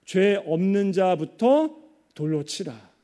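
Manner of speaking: slow, deliberate scripture quote; measured quotation. Retold or imitated. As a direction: weigh out each phrase slowly, with pauses. Solemn quote of Jesus's words.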